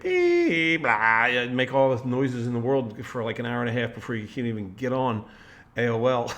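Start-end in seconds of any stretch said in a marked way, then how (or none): 0.0-1.3 dial-up modem noise. Retold or imitated. Recalling a fifty-four baud modem connecting, loud beep imitation. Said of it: "that would be like".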